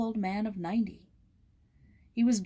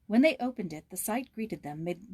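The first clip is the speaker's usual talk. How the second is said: usual speaking voice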